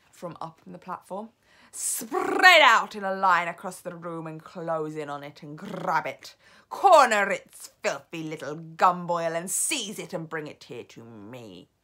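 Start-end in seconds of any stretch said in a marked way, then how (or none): 1.7-11.7 commanding witch. Acted. Grand High Witch giving orders.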